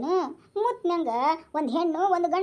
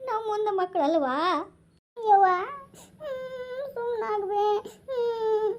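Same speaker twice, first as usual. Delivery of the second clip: crying through the words; sobbing; 4 semitones higher, much slower than usual. Acted. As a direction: break down in sobs. Mother breaks down crying. (ಬಿಕ್ಕಿ ಬಿಕ್ಕಿ ಅಳುವುದು)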